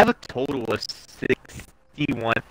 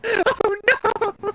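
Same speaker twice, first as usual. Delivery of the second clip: squealing oh no, much higher; mock panic; much slower than usual. Real, not acted. Gamer realizing the dreaded challenge, squealing dismay. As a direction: yelp in playful dismay.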